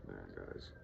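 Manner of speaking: low, very soft after a sigh; weary. Real, not acted. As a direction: let it sag out, worn down. Sighs, discouraged about learning job.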